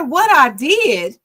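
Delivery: what I did, in disbelief; incredulous repeat of his words; indignant echo. Retold or imitated. Outraged echo of husband.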